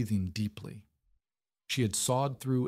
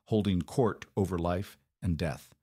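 ordinary delivery

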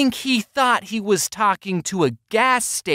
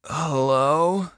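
fake wide-awake voice; 5 semitones lower than usual. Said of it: "answer the phone with my best ... voice".

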